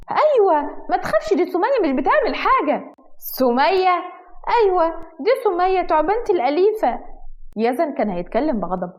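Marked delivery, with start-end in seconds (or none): 0.1-7.0 put-on much higher, sweet girlish voice; chirpy girly voice. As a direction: brightly defend the pet snake like a girl. Girl defends her pet snake.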